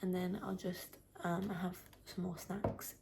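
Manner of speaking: whispering so no one wakes; hushed early-morning whisper; quiet at night. Real, not acted. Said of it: "I need to be quiet because I don't wanna wake anyone up".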